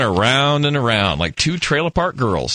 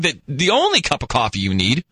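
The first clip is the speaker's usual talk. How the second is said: ordinary talk, nothing marked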